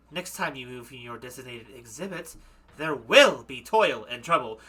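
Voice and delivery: mildly stern warning, stressed WILL; lightly voiced threat, slowing at the end; deadly serious. Witch warns her waxwork to stay put, deadly serious. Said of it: "She sounds deadly serious".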